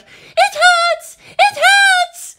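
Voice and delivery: very high, loud shriek; screaming. A narrator's reading. Captive woman screaming as cover while attacking, screaming. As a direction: scream it twice in mock agony. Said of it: "she screamed out".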